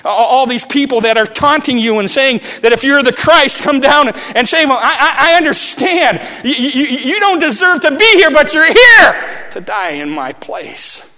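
high, loud, emotional proclamation; thief's voice swelling into a shout; impassioned preaching. Acted. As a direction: cry it out in awe. Preaching at full force.

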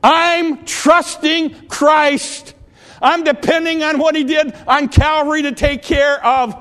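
loud, high-pitched pulpit preaching; full-force revival preacher; fervent preaching. Acted. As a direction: drive it home to the congregation.

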